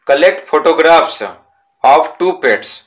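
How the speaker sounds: each word slow and separate for the class; slow reading aloud. Shown as a demonstration. Teacher dictating a textbook sentence to pupils, slow and deliberate. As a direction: read it out slowly so pupils can write it down.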